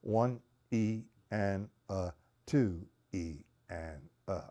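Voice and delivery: slow down the count so each beat is clear. Shown as a demonstration. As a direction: count it out slowly, one syllable at a time. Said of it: "It's a little too fast".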